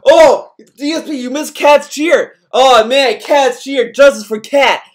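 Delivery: loud, high mock outrage; mocking chat outcry. Streamer mocking chat hounding DSP, loud high mock outrage. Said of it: "a storm of people like".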